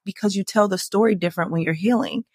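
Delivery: normal talking voice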